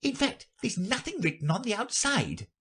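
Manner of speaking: high-pitched rabbit reporting; high, light courtier rabbit. Acted. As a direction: explain in a very high voice.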